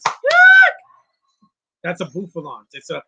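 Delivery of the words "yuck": mock high yelp. Reacting to battle on screen.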